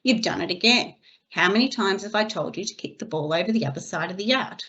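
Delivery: normal talking voice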